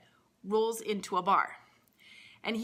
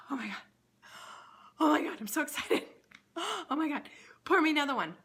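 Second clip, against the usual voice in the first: giddy excitement; 7 semitones higher, faster than usual. Acted. Bill begs the bartender for another drink, giddy and breathless. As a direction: gasp and gush like a kid at Christmas.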